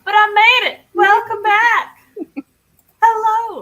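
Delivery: loud, high, delighted welcome back; excited greeting. Real, not acted. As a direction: greet a long-lost friend. Greeting returning viewer.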